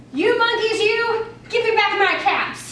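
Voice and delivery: a peddler shouting up at thieving monkeys; peddler scolding. Acted. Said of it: "he spoke to them".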